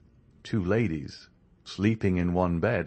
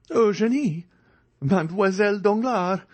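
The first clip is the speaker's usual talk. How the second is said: much higher, louder astonished cry; stupefied exclamation; 13 semitones higher, 5 dB louder than usual. A narrator's reading. Andrea recognises the lady in the bed, stunned. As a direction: blurt the names in shock. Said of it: "exclaimed Andrea, stupefied".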